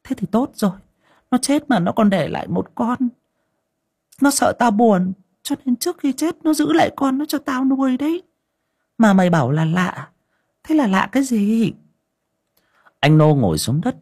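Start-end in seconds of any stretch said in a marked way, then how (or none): 0.0-11.7 high, pleased granny voice; old mistress cheerfully telling the servant about the kitten; cheerful old woman. Acted. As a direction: comfort yourself with the cat's gift. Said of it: "Bà Linh vui vẻ".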